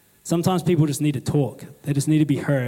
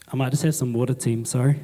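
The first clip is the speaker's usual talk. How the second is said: ordinary delivery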